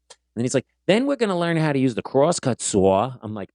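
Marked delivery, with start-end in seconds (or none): 0.9-3.1 imitating a Boston shop teacher's lesson; broad Boston vowels, teacher announcing the tool; thick Boston accent. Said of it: "had the thickest Boston accent".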